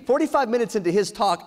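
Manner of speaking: ordinary delivery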